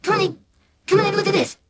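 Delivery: very high, rapid calling out; frantic high-pitched summons; shouting for mum. A narrator's reading. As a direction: call someone in urgently from another room. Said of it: "He shouted for my mum".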